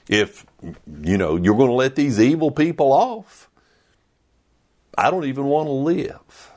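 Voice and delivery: mock petulant whine; fast indignant rant. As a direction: rattle it off like a sulking prophet. Paraphrasing Jonah's tantrum.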